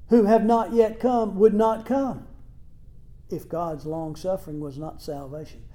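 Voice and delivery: ordinary delivery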